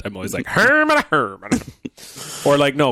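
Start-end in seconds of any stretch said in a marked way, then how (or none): 0.5-1.5 mock Southern accent through a full mouth, first word high. Co-host shows his mouth-full voice, a Southern accent. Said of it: "like, a southern accent".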